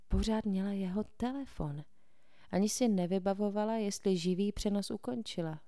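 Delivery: ordinary delivery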